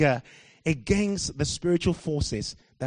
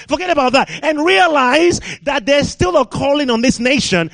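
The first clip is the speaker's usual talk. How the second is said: preaching at full force; 8 semitones higher, 9 dB louder than usual. Real, not acted. Preacher rousing the British church, loud full-force preaching. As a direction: thunder it at the congregation.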